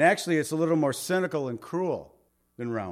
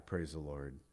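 ordinary talk, nothing marked

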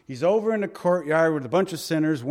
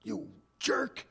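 the way Jesus did not speak to Judas, as a mock sneer; mock angry rebuke; 7 dB quieter than usual. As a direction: play the bitter betrayed friend, half in jest.

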